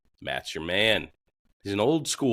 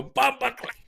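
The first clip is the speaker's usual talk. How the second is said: hammed-up Jamaican exclamation, much higher; Jamaican accent. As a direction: blurt it in a playful fake Jamaican accent. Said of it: "it just makes you wanna go".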